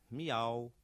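short drawn-out meow; plain cat miaow from the narrator. Acted.

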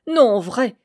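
loud, roaring exclamation; laughing outburst. A narrator's reading. Mason roaring with laughter at the scheme, loud outburst. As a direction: fling it out mid-laugh. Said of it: "lança-t-il".

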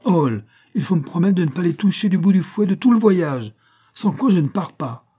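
high, loud, pouting plea; childish pout. A narrator's reading. Mercedes demands Hall spare the dogs, pouting childishly. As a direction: plead and threaten like a spoilt child. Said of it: "s'écrie-t-elle avec une moue enfantine".

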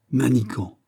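a touch of indignation; restrained angry rebuke by name; mildly angry cry. A narrator's reading. The count rebukes his mocking friend, mildly angry. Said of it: "s'écria le comte avec colère".

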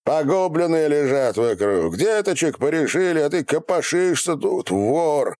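high, sustained accusatory shouting; accusing shout. A narrator's reading. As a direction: shout the accusation right at him. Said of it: "крикнул ему в самое". (обвиняющий крик в лицо)

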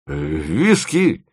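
slow, low drawl with a late jump up; tipsy discovery. A narrator's reading. Drunk man tastes his wet hand, tipsy drawl. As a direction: draw it out like a drunk.

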